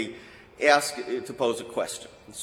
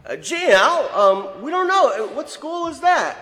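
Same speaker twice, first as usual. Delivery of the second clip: puzzled classmates; 9 semitones higher, 3 dB louder than usual. Retold or imitated. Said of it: "they all go".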